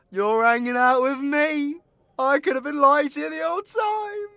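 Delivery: high, loud, rising taunt. Acted. Storyteller taunts listeners about lying to them, loud taunt.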